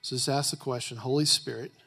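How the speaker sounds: quiet, low prayer over music; soft prayer. Acted.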